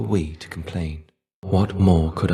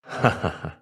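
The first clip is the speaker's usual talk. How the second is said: a quiet ha ha ha; soft laugh; 2 semitones lower, 5 dB quieter than usual. Acted. Shade noticing someone has come, a quiet amused laugh. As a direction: chuckle softly at the newcomer.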